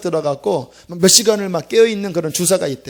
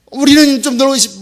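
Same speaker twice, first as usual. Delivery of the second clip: put-on higher, louder sulky teen whine; whining students; 7 semitones higher, 6 dB louder than usual. Students complaining to the pastor, a loud sulky whine. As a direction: pout like a kid dragged to church. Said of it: "아이들이 이렇게 막 세상 같아 돌아오면요".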